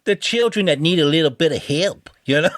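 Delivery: high, mocking Massachusetts accent. Shown as a demonstration. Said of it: "'Cause they sound like".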